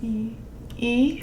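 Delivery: E said with lips spread, little gap; mouthing the E sound. Shown as a demonstration. Coach demonstrating E for lip sync. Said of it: "For E".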